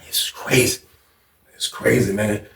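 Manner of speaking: soft, under the breath; hushed shock; whispered disbelief. Retold or imitated. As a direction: murmur it to yourself, stunned. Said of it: "I'm like".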